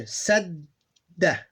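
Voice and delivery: "sadda" with a held d and short final a; teacher's deliberate demo of double d in "sadda"; doubled consonant. Shown as a demonstration. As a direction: linger on the middle d of "sadda". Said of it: "these two become one".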